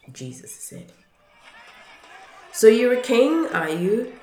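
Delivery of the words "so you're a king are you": loud, high-pitched mocking question; big derisive jeer. A narrator's reading. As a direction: taunt him like a scornful soldier. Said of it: "the Roman soldiers jeered".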